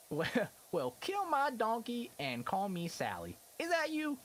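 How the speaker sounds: hammed-up Texas twang; Texas accent. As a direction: draw out the folksy Texas saying. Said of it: "Texas accent".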